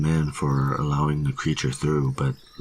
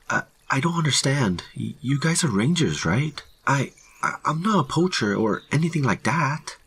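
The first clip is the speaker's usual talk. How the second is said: high, stammering, rushed protest; nervous pleading; 11 semitones higher than usual. A narrator's reading. Hiker insisting to rangers he's no poacher, high and nervous. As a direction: stumble over words, anxious to explain.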